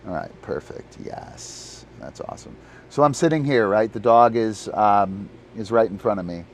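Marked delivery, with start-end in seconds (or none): 0.1-2.6 whispered aside to himself; hushed, unvoiced yes that's awesome; muttered under breath. Real, not acted. Whispering to himself while setting up camera.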